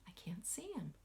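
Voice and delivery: breathy stage whisper. Acted. Teacher whispering to children that she cannot find him. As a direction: whisper as if the mouse might hear. Pretend searching, hushed.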